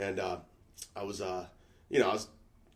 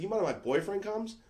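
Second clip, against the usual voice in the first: higher, lightly voiced girl; a hint of a girly voice asking casually; girl's voice; 9 semitones higher, 4 dB quieter than usual. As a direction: ask it like the girl would. Voicing the girl.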